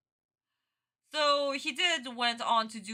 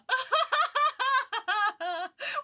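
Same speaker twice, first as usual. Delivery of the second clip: high, long squealing laughter; hysterical laugh; 13 semitones higher than usual. Real, not acted. Streamer hysterical over second casting, laughing. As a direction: dissolve into high helpless laughter.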